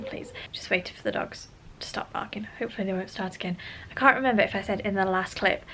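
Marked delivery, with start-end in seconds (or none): 0.0-3.6 hushed whisper so the dogs stay quiet; whispered aside. Real, not acted. Vlogger whispering while her dogs bark. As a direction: breathe it out under the barking.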